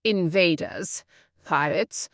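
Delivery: ordinary talk, nothing marked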